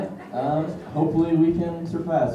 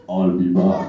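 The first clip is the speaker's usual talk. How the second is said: Arnold impression; 8 semitones lower, slower than usual. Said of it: "do some impressions".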